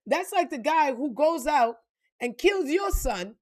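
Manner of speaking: ordinary delivery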